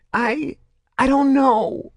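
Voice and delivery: high, loud trembling stammer. A narrator's reading. Grotto admits ignorance to Saren, trembling. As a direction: let the voice tremble. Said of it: "his voice trembling".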